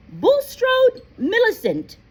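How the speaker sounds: loud roll call; calling out a name. Acted. McGonagall calls the next student up, loudly. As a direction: call the name across the hall.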